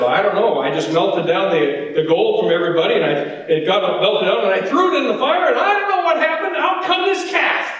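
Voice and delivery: hurried mock innocence, pitch building to the end; Aaron's excuse. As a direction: start plainly, then rise into helpless protest. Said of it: "his brother Aaron said".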